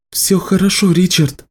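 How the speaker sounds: high, gentle comforting voice; reassuring. Acted. Nicci soothes Richard.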